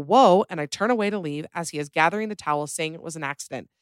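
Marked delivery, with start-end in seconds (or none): 0.0-0.4 startled yell. Retold or imitated. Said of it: "I yell".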